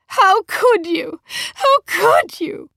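high, loud cry of outrage, drawn out; outraged wife's high, shaking shout; trembling outrage. A narrator's reading. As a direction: wail it slowly, trembling with fury. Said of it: "voice was trembling with outrage".

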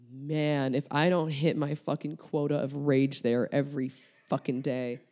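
ordinary delivery

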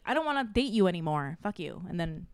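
usual speaking voice

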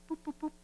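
high, soft mock healing boops; silly high boop noises; playful boops. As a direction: tap out a cartoonish healing sound. Imitating healing sound.

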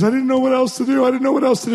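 the pastor breaking down as he admits it; retold weeping. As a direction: cry it out, voice climbing. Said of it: "he just starts weeping".

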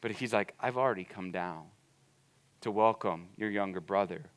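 soft, low, gentle pleading; hushed fatherly invitation; tender father. Acted. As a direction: coax him in quietly, like a loving dad. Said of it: "the father is going".

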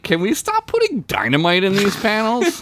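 high-pitched incredulous complaint; hammed-up pleading protest; mock exasperation. Crew member complaining about exploding panels, mock exasperated.

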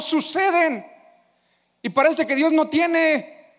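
rally the congregation to believe it; full-force preaching. Acted. Preacher urging the church to believe.

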